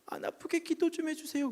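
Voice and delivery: high pleading voice asking for prayer; pleading sick man. Retold or imitated. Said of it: "그러는 거예요". (애원하는 환자 목소리)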